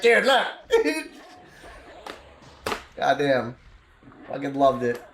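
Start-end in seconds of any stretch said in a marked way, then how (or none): 0.0-0.5 high, eager show-off; excited gnome Toby. Retold or imitated. Gnome to his travelling companions, playfully excited. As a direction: imitate a playful gnome begging for attention. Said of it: "He's like".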